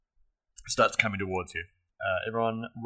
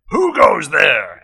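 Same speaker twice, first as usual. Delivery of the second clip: orc shout; 7 semitones higher, 16 dB louder, much slower than usual. Acted. GM voices orc at door.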